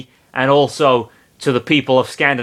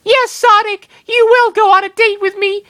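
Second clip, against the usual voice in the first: put-on much higher, louder girlish threat; menacing Amy; 5 dB louder than usual. Amy threatening Sonic, much higher and louder. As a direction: sweetly demand the date. Amy voiced, surrounded by fire.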